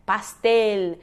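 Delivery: "Pastel" ending on a full tongued L; clearly pronounced L. Shown as a demonstration. Teacher telling students to sound it, clear final L. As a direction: land firmly on the L of "Pastel". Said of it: "Tem que pronunciar bem esse L".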